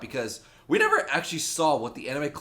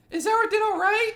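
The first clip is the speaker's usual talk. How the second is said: very high put-on schoolgirl voice; high girly character voice. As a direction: pitch it way up for a game's girl. Voices the girl in the game.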